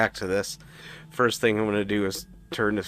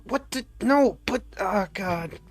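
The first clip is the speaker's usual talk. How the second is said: exasperated; 10 semitones higher, slower than usual. Acted. Character exasperated at Solaris.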